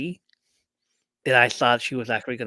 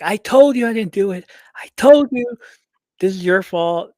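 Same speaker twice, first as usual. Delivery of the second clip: high, loud accusing yell; mock yelling; 8 semitones higher, 10 dB louder than usual. Liv Morgan yelling at Bianca, high and loud. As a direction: yell it like blaming her. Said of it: "who's yelling at".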